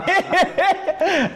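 very high, squealing run of laughter; high-pitched laugh. Real, not acted. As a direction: let out a long laugh way up high. Laughs after admitting he got watery.

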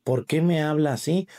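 ordinary delivery

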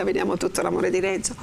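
ordinary delivery, nothing marked

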